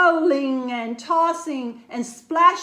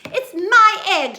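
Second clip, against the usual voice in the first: loud high indignant burst; sputtering anger; 6 semitones higher, 7 dB louder than usual. A narrator's reading. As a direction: spit it out in outrage. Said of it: "she sputtered".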